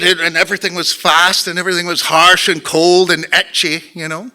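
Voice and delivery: broad Glaswegian storytelling; Scottish accent. Shown as a demonstration. Telling the audience about school in dark Glasgow, Scottish accent. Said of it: "I can still speak like, like this".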